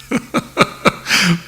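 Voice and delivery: a short airy chuckle at the slip; laughing at a tongue slip; soft breathy laugh. Real, not acted. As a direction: laugh off the stumble lightly.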